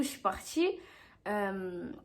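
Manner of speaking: ordinary talk, nothing marked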